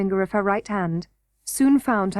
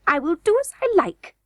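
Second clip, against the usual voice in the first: sulky retort; 8 semitones higher than usual. A narrator's reading. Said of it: "retorted the queen sulkily".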